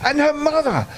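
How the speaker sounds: the bear spots his family; a mild glad cry, high; excited cry. Acted. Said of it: "cried Ernest".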